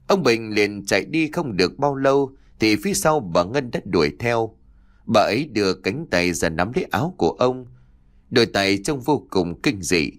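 usual speaking voice

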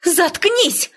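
indignant exclamation; sharp cry out. A narrator's reading. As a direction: cut the sister off, pitch jumping up. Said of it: "выкрикнула я".

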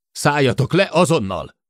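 shouted order. A narrator's reading. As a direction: order them down at once. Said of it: "Kár kiabálnod, Jill!". (kiabálva kiadott parancs)

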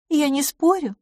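high, meek agreement; obedient. A narrator's reading. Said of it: "послушно сказала мисс Лафос". (послушно, высоким голосом)

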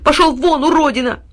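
high, loud furious yell at the dog; angry boy shouting. A narrator's reading. Boy yelling at the dog to get out. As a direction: chase him off with a shout. Tyltyl drives the dog away.

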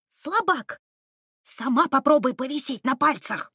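indignant. A narrator's reading. Squabble while hanging.

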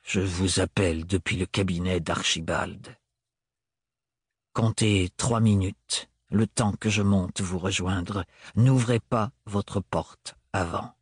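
voix plus grave, lente et distante; distant et dur. A narrator's reading. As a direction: Thorne froid et lent, détachant les mots. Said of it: "son intonation distante et ses accents durs". (Thorne redevient distant au téléphone, grave et dur)

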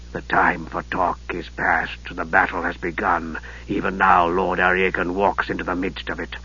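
low, soft, sinister mage; Raistlin's voice. Acted. Raistlin greeting his nephew, low and softly sinister. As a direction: hush it like a frail dark wizard.

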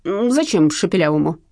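plain reading voice

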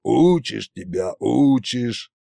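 drawn-out fatherly grumble; lightly voiced exasperated father; weary reproach. A narrator's reading. Tsar father scolding his son, weary.

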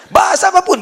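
normal talking voice

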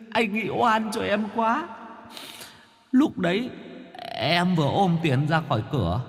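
put-on high, soft, whiny young ghost pleading; whimpering ghost. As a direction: whine and grovel like a scared ghost. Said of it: "Vong thằng Quân mếu máo".

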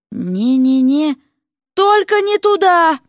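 high, drawn-out pleading; panicked refusal. A narrator's reading. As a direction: plead not to go in there. Дерек в панике у двери. (панически упираясь)

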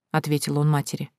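plain reading voice

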